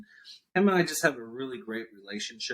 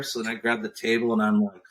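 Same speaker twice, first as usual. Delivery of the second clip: ordinary delivery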